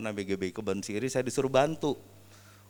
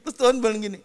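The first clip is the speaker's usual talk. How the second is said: usual speaking voice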